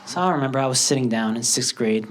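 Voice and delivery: usual speaking voice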